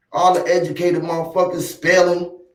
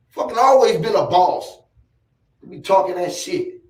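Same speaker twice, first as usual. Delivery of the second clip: ordinary talk, nothing marked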